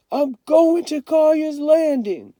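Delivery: high, loud, slow called-out vow; calling after them. A narrator's reading. Kenny shouts his plan after the departing siblings. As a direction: shout it down the road.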